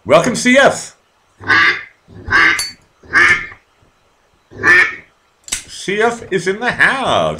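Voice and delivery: high, loud announcer welcome; loud hyped greeting for a new guest; big welcome. Real, not acted. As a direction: call it out like a host hyping a guest. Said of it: "I always blow this one".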